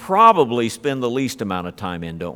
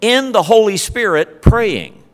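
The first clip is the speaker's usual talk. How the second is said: "Holy" lifted much higher and louder; emphatic word order; 7 semitones higher, 4 dB louder than usual. Shown as a demonstration. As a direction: hammer "In the Holy Spirit praying", peak on "Holy". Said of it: "called the emphatic order".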